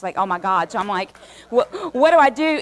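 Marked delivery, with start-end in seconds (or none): 1.5-2.6 a high, loud panicked cry for help; retold panic. Panicking about the moderator who needs to sit down, high, loud alarmed yelp. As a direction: yelp it out, suddenly much higher and louder, at a loss.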